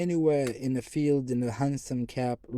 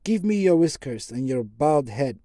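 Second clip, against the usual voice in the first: demanding; 3 semitones higher, 8 dB louder than usual. Acted. Basil madly demanding whiskers.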